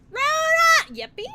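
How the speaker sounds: high, loud calling a name; excited shout. Real, not acted. Streamer greeting a viewer, excited shout. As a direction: call out delighted.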